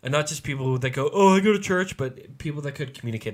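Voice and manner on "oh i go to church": mocking churchgoer. Mocking casual churchgoer quote.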